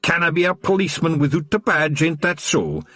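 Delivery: high Scots voice, gloating; a wee copper who caught them red-handed; smug Scottish watchman. A narrator's reading. Wee Mad Arthur to the Feagles, gloating Scots voice.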